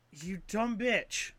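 slow, dragged-out scornful insult; deadpan slow put-down; slow contemptuous insult. Retold or imitated. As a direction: let the contempt drip slowly. Insult at game character.

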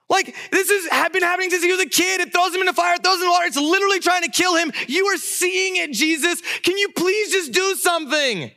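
very high, frantic pleading; desperate dad. Retold or imitated. Dad begging Jesus to help his son, desperate. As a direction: imitate a father begging at the top of his range. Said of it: "That's the desperation that this dad has".

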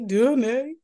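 loud "Danae" with a rising end; mock exaggerated name. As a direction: hammer out "Danae" in teasing mimicry. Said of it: "Just like you ain't had to say it".

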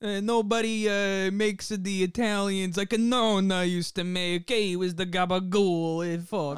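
thick mock Italian-American mobster accent. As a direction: parody an Italian-American wiseguy, loud and high. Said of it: "the American Italian, specifically from Jersey".

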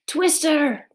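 high-pitched cry of alarm; yell. A narrator's reading. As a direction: call out a sharp warning, pitch falling at the end. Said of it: "Pete yelled".

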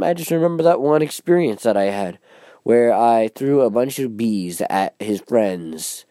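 ordinary talk, nothing marked

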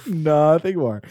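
laughing. Real, not acted. Laughing at the scene.

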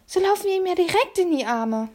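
put-on high, loud hissed boy voice; hissing boy. A narrator's reading. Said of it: "zischte er".

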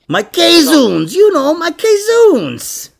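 high, drawn-out Italian-American voice; Italian-American mook. Acted. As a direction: explain it as an exasperated New York Italian guy. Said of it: "like an Italian guy".

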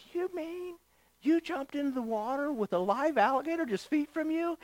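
high, hushed mock amazement; hipster voice. Guide marvelling at the tourist's leap, in a hipster voice. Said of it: "he says in his hipster voice".

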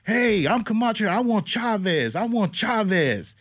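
high, slow hyped-up boxer shouting his challenge; hammed-up Camacho. Camacho at the border, distracting the patrol, high slow hype. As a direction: crow it out like a showman drawing a crowd. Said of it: "starts saying".

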